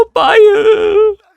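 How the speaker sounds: imitação de choro agudo e exagerado, bem mais alto e lento; filha fingindo choro. Retold or imitated. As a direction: golpista fingindo ser a filha chorando ao telefone. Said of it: "começam a fingir ser a sua filha. Começa a chorar". (choro fingido, agudo e alto de filha em apuros)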